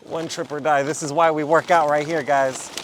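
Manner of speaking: loud and strained under heavy bags; straining, loud. Real, not acted. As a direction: push the words out while lifting. Hauling all groceries in one trip.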